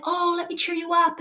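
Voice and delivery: high, mock cheerful cheer-up voice; mock cheery helper. A friend trying to cheer up a sad kid, high mock cheerful. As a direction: sing it out like an eager cheerer-upper.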